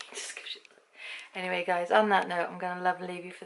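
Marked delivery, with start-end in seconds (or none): 0.0-0.8 fast, hushed amused aside; giggling under breath; laughing whisper. Real, not acted. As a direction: whisper it through a chuckle. Amused aside about dog.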